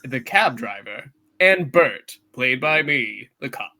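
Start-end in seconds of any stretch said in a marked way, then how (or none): none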